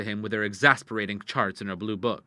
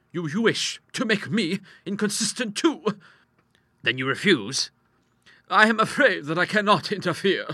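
higher-pitched, polite woman refusing; lightly voiced widow; 9 semitones higher, 4 dB louder than usual. Widow turning down the doctor's plea, lightly voiced in higher pitch. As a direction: decline politely in a raised voice.

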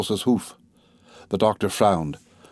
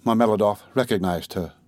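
plain reading voice

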